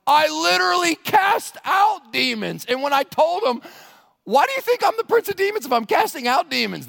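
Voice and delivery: loud, high, slowed outburst; preacher bellowing Jesus's exasperation; exasperated Jesus. Retold or imitated. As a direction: shout it like he's fed up with them. Jesus retold, preacher acting him out.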